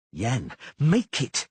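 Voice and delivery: slow, loud pained bellow. A narrator's reading. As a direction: force it out through the pain. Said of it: "she bellowed".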